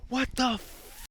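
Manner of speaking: put-on high, drawn-out, loud drunken tirade; screaming at police. Imitating his drunk friend facing the police, screamed outrage. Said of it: "screaming at the police".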